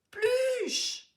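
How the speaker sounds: very high frantic cry of a pet's name; waking in fright and calling out; panicked call. Acted. Child wakes from a nightmare, frantically calls the pet.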